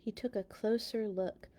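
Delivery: plain reading voice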